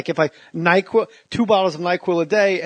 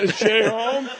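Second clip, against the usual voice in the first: lightly put-on Mexican accent, a bit higher; Mexican nanny accent; 7 semitones higher, slower than usual. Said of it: "impression of a Mexican nanny".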